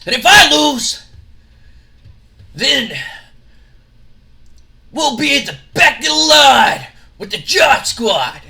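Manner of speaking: high, loud promo voice; wrestler shout. Acted. Scott Steiner setting match stakes with Shane, loud high promo. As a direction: bark the terms like a heel.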